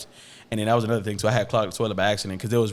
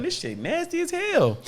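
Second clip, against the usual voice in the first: much higher, slow, drawn-out revulsion; mock disgust; 4 dB quieter, slower than usual. Patient seeing the mess on his back, high slow mock disgust. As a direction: stretch it out, grossed out.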